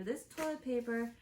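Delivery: normal talking voice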